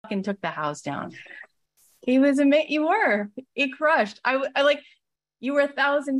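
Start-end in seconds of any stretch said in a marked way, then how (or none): 2.0-6.2 high and loud, bursting with praise; gushing excitement. Real, not acted. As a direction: rave about him, almost squealing. Gushing about his great show.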